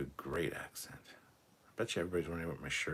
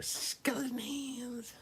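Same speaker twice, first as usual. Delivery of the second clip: squeaky; much slower than usual. Acted. Jumps into the bit.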